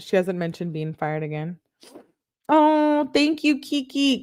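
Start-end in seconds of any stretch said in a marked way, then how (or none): 2.5-4.2 high-pitched, drawn-out sweet thanks; gushing thanks. Real, not acted. Streamer thanking Kiki for a super chat, gushing. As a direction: coo it way up high.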